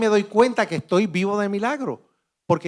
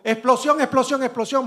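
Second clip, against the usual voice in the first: mildly raised, repeated warning cry; retold shouting; 6 semitones higher than usual. A burned man runs down the hallway, a mild retold cry.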